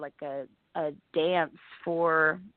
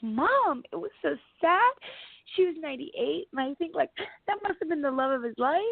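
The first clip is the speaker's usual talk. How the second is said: put-on higher voice of an upset little girl pleading with mom; upset kid pleading; 10 semitones higher than usual. Young daughter explaining her sobbing to mom, higher pleading kid voice. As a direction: plead your case to mom like a heartbroken kid.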